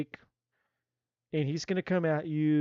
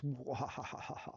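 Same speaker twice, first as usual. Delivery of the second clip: quiet laugh; 4 semitones lower, 14 dB quieter than usual. Real, not acted. Laughs at own reading.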